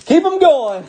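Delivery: high, cheerful imitation of a chat cheer; quoting a viewer. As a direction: squeak out the viewer's cheer in fun. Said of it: "Todd says".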